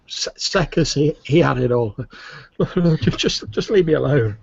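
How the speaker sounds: halting, high-voiced protest from a broken captive; feeble prisoner. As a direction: play a weakened prisoner pleading to be left alone. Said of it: "it's very faint. You have to strain".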